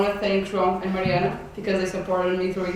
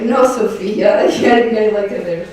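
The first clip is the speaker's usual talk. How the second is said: laughing correction; 3 semitones higher, 6 dB louder than usual. Retold or imitated. Friend correcting her, retold.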